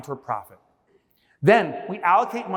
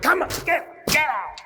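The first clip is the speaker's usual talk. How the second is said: mock yell; 9 semitones higher than usual. Slamming tube in the door.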